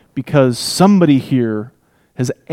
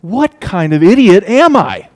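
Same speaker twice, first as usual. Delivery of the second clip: higher, louder exasperated self-rebuke; self-mocking reader; 6 semitones higher, 5 dB louder than usual. The reader mocks himself, exasperated and louder. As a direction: scold yourself like a foolish reader. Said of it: "the reader has to go".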